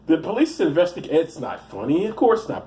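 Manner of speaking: ordinary talk, nothing marked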